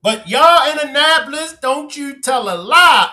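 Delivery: high-pitched teasing protest; indignant scolding. Real, not acted. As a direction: call out the fib, pitched high. Teasing a viewer who claims better.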